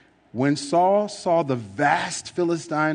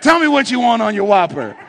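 high, loud, quick order-taker voice; fast-food cashier; 9 semitones higher, 7 dB louder, faster than usual. Retold or imitated. Preacher mocking the medium as a cashier, high quick voice. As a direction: chirp it like a drive-thru clerk. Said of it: "talking like it's Burger King".

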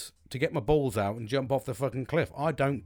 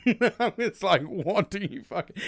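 laughing disbelief; 7 semitones higher, 5 dB louder, much slower than usual. Real, not acted. Laughing reaction to mate's jab.

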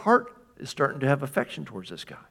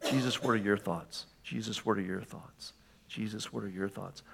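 quiet prayer; 4 semitones lower, 8 dB quieter than usual. Pretend. Earnest prayer to Jesus.

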